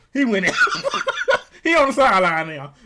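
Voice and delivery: loud, laughing commentary; helpless, high laughter breaking in; cracking up. Real, not acted. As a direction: blurt it out through big laughs. Laughing at player leaving the field.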